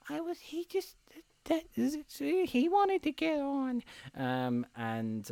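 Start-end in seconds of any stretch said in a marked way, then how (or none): 0.1-3.8 put-on small, high, timid child voice; Hero Boy. Boy explaining himself to the conductor, high soft stammer. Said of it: "the Hero Boy's like".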